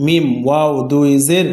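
usual speaking voice

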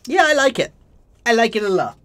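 delighted. Real, not acted. Delighted at mortar.

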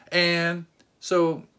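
ordinary delivery

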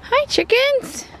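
high, drawn-out hello to pets; high-pitched animal talk; singsong animal greeting. Real, not acted. Greeting the chickens.

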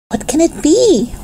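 put-on high-pitched, curious girl's question; wondering. A narrator's reading. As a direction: wonder aloud, pitched well up. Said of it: "wondered Violet".

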